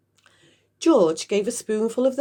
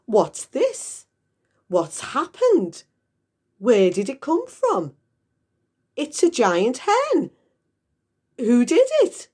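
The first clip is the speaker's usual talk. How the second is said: higher, loud excited questions; worked-up father; 7 semitones higher, 5 dB louder than usual. Acted. Mister Cranky yelling at the hen in excitement, higher and louder. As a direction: fire off questions in a frenzy.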